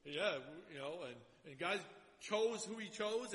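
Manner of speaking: ordinary talk, nothing marked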